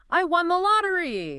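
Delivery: high, loud, thrilled shout; super excited yell. Shown as a demonstration. Friend runs in yelling the big news, super excited. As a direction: burst in yelling with joy. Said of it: "yells, super excited voice".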